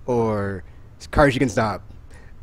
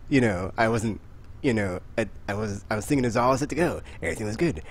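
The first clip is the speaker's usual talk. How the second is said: ordinary talk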